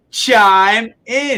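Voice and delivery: a Brit hamming up an American chime in; mock American. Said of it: "Is that what we say in America?".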